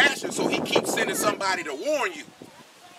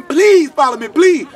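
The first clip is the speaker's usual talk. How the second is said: mock begging; 5 semitones higher, 9 dB louder, slower than usual. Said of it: "He ain't go around there begging".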